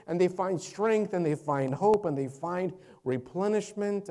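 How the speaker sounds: loud, high, driving proclamation; full-force preaching. Real, not acted. Preacher declaring God's refuge to the congregation, full-force preaching. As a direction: proclaim scripture in a mounting, urgent cascade.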